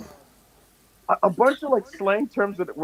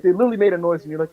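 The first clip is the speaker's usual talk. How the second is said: ordinary talk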